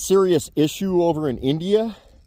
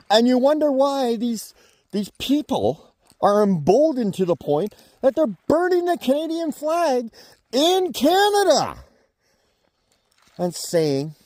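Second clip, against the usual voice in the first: climbing much higher, slowing on Canada; building outrage; 10 semitones higher than usual. Real, not acted. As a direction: build to an indignant peak. Flag burning outrage.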